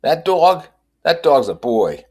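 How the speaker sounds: high, loud hawker selling puppies; street dog seller. A narrator's reading. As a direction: pitch the seller up, pushing a sale. Seller voiced.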